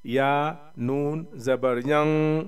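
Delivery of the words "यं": यं held as a long nasal hum; nasal ghunna. Shown as a demonstration. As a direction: let यं ring on in the nose. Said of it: "same उसी हिसाब से".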